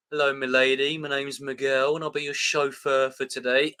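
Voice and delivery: mock British accent, level and measured; hammed-up British chauffeur greeting; bad British accent. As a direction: imitate a poor British accent, evenly paced. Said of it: "say that's a good British accent".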